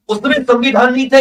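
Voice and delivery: ordinary talk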